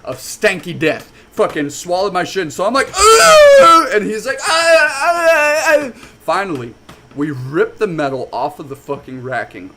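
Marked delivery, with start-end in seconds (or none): 2.9-3.9 very high, loud squeal of revulsion; piercing grossed-out cry; disgusted scream. Retold or imitated. Retold disgust at shin contact. 4.4-5.9 high, loud repeated "ah" yells; string of high strained wails; pained cries. Retold or imitated. Retold lifter crying out.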